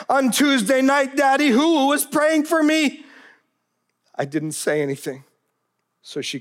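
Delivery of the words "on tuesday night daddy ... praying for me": much higher, insistent interrogating tone; cross-examining. Retold or imitated. Daughter grills her father about the prayer, insistent. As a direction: press the witness hard for the truth. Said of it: "like that of a cross-examining attorney".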